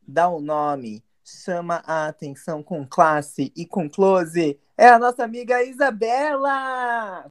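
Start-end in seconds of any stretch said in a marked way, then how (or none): 4.8-7.3 high shout of welcome; excited announcement. Acted. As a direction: shout it like a show host. Introducing the guest with cheer. (anúncio animado gritado)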